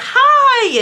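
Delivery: high, sugary greeting; sweet as pie to the others; mock-sweet waitress hi; fake polite hi. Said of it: "she literally was like".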